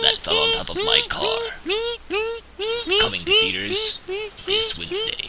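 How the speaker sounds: squeaky high, slow promo line; absurdly high mock trailer read. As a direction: pitch it way up for the gag. Movie trailer announcer parody.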